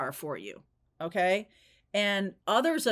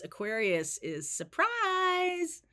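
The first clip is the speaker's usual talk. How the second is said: drawn-out high surprise; 8 semitones higher, slower than usual. Real, not acted. Stressing the surprise meaning.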